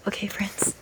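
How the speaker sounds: hushed unvoiced whisper. Real, not acted. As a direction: softly draw the viewers in. Hushed address while drawing.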